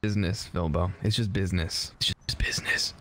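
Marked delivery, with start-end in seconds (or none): 2.0-2.9 breathy dramatic whisper. Pretend. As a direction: whisper it like a mob boss. Repeated as whisper.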